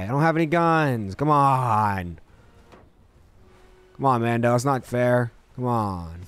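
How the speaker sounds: ordinary delivery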